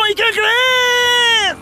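high, long drawn-out storyteller's tease; hammed-up suspense. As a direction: stretch it high to build the suspense. Suspenso antes del choque. (suspenso exagerado, agudo y alargado)

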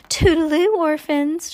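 high, loud toodle-oo; sing-song goodbye. Acted. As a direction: call out a high-pitched, showy farewell. Shirley's mocking wave goodbye.

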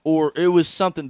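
normal talking voice

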